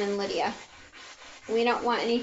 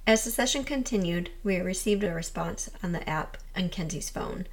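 calm documentary voiceover; voiceover narration; 4 semitones lower than usual. A narrator's reading. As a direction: narrate it like a ghost-hunt recap.

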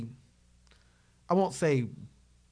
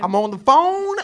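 mock businessman voice; 5 semitones higher, 6 dB louder than usual. Impersonating Sean's businessman role.

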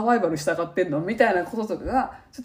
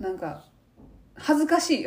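ordinary talk

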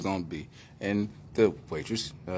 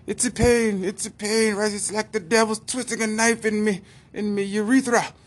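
higher, louder Irish complaint; mock Irish accent; 13 semitones higher, 3 dB louder than usual. Malcolm telling the doctor his pain, higher Irish accent. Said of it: "Irish Malcolm voice".

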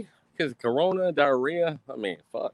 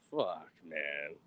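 muttered curse; 10 semitones lower, 12 dB quieter than usual. Retold or imitated. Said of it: "I was like".

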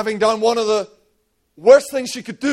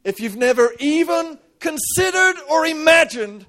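impassioned preaching; 6 semitones higher than usual. Real, not acted. Preacher pressing the congregation, ringing out fervently. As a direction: build to a fervent peak.

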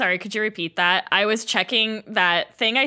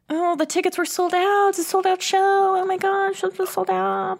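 higher, put-on grumbling crowd voice; complaining crowd; 9 semitones higher than usual. People in the long line grumbling about the sold out show. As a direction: whine like a disappointed fan in line. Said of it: "people mumbling and complaining like".

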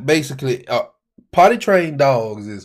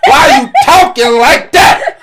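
rising to a shout; exasperated dad yelling; irritated parent yell; 13 semitones higher, 7 dB louder than usual. Retold or imitated. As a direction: scold the kid, getting louder. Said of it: "I'm like".

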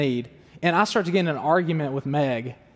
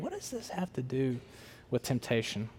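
puzzled aside; 6 semitones lower, 12 dB quieter than usual. Pretend. Imagined reader's puzzled thought.

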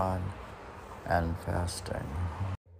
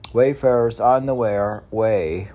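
louder, higher call; proclaiming; 5 semitones higher, 9 dB louder than usual. Acted. Addressing wayfarers, a loud proclamation. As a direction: call it out to the travelers.

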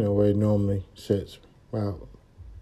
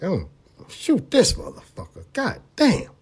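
a rising, higher-pitched mock rage; retold fury at the other driver; angry thought; 9 semitones higher than usual. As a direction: spit out the threat, climbing high. Furious inner thought after crash.

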